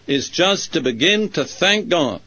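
normal talking voice

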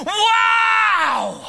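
a huge, high cry of wonder; heavenly creatures crying out in amazement at God; awestruck shout. Acted. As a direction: shout it out in overwhelmed awe. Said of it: "they're just going".

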